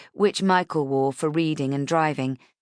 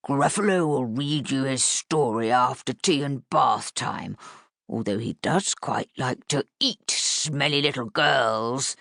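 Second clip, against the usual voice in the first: growly Gruffalo voice; 3 semitones lower than usual. Acted. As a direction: play the Gruffalo to make the girls giggle. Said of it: "best growly Gruffalo voice".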